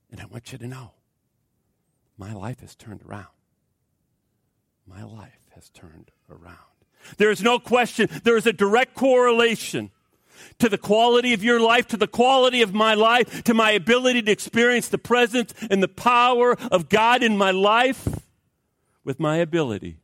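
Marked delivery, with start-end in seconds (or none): 0.1-6.6 much softer, lower, fading to almost nothing; hushed testimony. Retold or imitated. Woman's closing testimony, hushed. 7.2-18.2 high, loud, rising preaching climax; preaching at full force. Acted.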